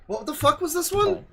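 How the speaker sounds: high incredulous what-the-hell; baffled outburst. Real, not acted. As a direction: voice jumps up high in disbelief. Baffled reaction to replayed clip.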